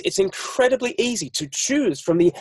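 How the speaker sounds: normal talking voice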